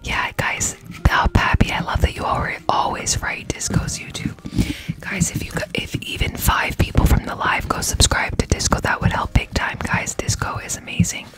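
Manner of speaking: soft ASMR whisper; ear cleaning asmr. Real, not acted. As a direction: whisper close to the mic.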